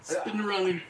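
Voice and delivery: ordinary talk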